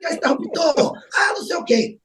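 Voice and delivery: put-on high-pitched shout, retelling a teen's outburst; yelling back. Teenage son yelling back at his mother about the light switch, shouting. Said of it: "não grite comigo".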